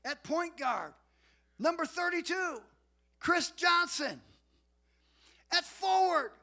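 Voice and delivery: booming, drawn-out stadium announcer call; arena announcer. Retold or imitated. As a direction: announce the starting lineup over the gym speakers. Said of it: "they do the starting lineup".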